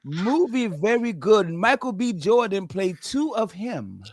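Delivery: put-on slow, comic Chinese-accented English; imitated Chinese accent, deliberate and slow; mock Chinese accent. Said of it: "perfect accent".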